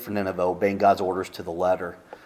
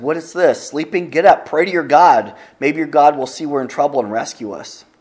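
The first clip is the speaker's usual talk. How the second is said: raised, higher urgent shout of a ship's captain; 5 semitones higher, 7 dB louder than usual. Retold or imitated. Ship's captain shouting at sleeping Jonah in the storm, urgent and loud. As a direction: roust a sleeper in a storm, loudly.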